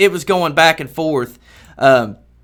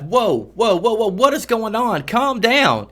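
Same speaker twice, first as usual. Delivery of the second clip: mock alarm; 5 semitones higher than usual. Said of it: "we're all freaking out".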